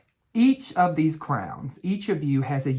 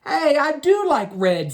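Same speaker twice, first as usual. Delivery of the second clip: much higher, louder little character voice; yellow crown; 10 semitones higher, 5 dB louder than usual. Acted. Yellow crown tells the coloring child it likes red, high voice. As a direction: pipe up high like a small crayon. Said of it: "said the yellow crown".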